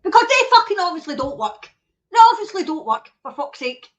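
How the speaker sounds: loud, high exasperated outburst; angry rant. Real, not acted. Streamer ranting at the tests, loud and angry. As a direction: let the frustration burst out loud.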